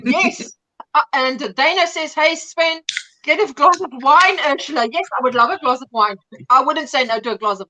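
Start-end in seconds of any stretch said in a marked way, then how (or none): none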